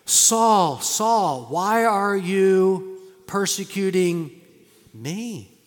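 slow, loud, grave heavenly call; voice of God. Retold or imitated. God calls Saul from heaven, slow and loud. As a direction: boom it out slowly like a voice from heaven. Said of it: "What did he say?".

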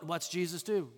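ordinary talk

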